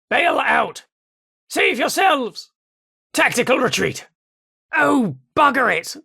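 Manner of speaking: high, alarmed retreat calls; panicked. Acted. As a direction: shout them back in a panic.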